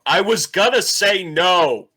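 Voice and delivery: loud, high-pitched indignant yell; yelling out the door. Acted. Shopkeeper to the pen-snatcher leaving, yelling out the door. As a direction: holler it after someone running off. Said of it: "John yells out the door".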